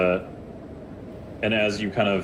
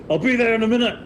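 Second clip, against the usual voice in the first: much higher, louder eager call; Dahlin voice; 14 semitones higher, 4 dB louder, faster than usual. Acted. Dahlin calling to the party before charging, high and loud. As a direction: toss it off high and bright as he runs. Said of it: "Dahlin is like".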